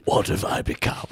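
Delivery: hushed, low, melodramatic whisper; dramatic whisper. Acted. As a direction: whisper it in mock horror. Mock dramatic self-horror.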